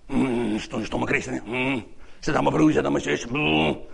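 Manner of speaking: low, sulky grumbling; hammed-up crabby moaning, pitched right down; grumpy. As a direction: grumble under your breath like a sourpuss. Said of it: "Je wordt chagrijnig".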